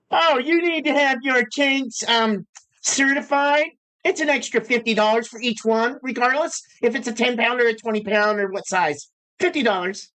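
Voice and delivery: high, put-on voice of a pushy shop clerk; mocking the clerk. Mocking the shop clerk to viewers in a high put-on voice. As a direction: ham up the clerk's sales patter, pitched well up.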